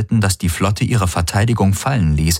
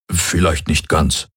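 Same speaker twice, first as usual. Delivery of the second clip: sheepish; 3 semitones lower, slower than usual. A narrator's reading. Hox to Galen, owning up: slow and sheepish. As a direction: admit it half-mumbled. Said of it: "sagte Hox etwas verlegen".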